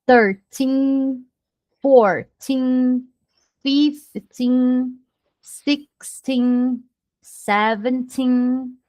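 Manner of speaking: put-on "thirteen" to "nineteen" with long held final "teen"; prolonged TEEN endings. Shown as a demonstration. Teacher drills teens so they differ from tens, endings drawn out. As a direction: stretch each "teen", slow and sustained. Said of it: "prolongue essa pronúncia".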